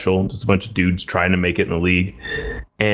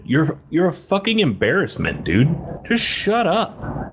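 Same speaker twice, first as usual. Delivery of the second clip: retold angry telling-off; 9 semitones higher than usual. To the jeering fan, retelling an angry telling-off. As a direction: bite off each word at him.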